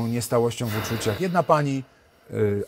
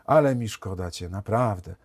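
mock pity; 6 semitones lower, 6 dB quieter than usual. Others pity him, quoted.

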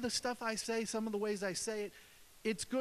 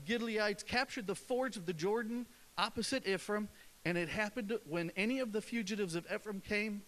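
ordinary delivery

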